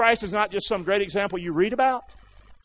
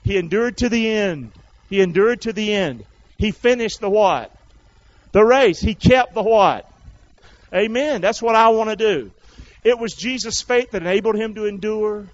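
raised, driving preaching; 激昂讲道; 5 semitones higher, 7 dB louder than usual. Real, not acted. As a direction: preach it out loud to the congregation.

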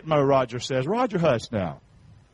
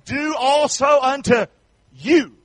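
louder rhythmic group recital; recited verse; 3 semitones higher, 7 dB louder than usual. Shown as a demonstration. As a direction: chant it so they learn it. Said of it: "Let's learn that verse".

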